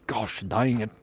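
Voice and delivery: soft whispered mild curse; whispered grumble. Real, not acted. Streamer finding the glass all wet, whispered grumble. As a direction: whisper it under your breath.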